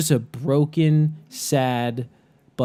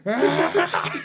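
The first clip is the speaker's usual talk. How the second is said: mock battle yell. Imitating someone ripping a headrest.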